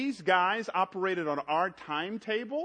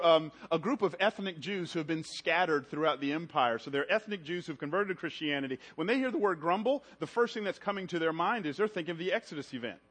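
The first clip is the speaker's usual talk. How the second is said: normal talking voice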